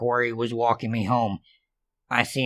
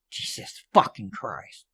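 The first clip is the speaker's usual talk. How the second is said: muttered curse; 2 semitones lower, 6 dB quieter than usual. Acted. Exasperated curse under breath.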